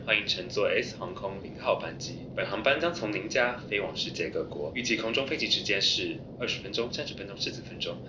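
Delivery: calm in-flight cabin announcement; flight attendant. Acted.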